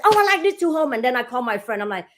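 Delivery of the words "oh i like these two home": excited client. Retold or imitated. Client's excited reply, acted.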